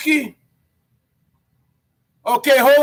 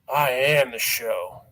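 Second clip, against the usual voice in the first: deep growl; 10 semitones lower, 6 dB quieter than usual. Acted. Catchphrase repeated, voice dropped.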